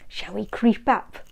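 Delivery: usual speaking voice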